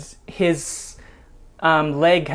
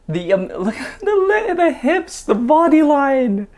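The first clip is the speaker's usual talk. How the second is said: giggly gushing; 9 semitones higher than usual. Real, not acted. Giggly gushing over dancers' hips.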